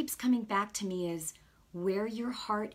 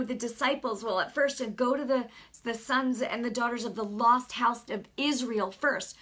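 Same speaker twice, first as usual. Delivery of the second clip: ordinary talk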